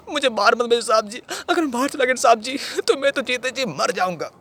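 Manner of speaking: high, loud, frantic begging; desperate pleading. Acted. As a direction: beg him as if your life hangs on it. Acted desperate begging to officer.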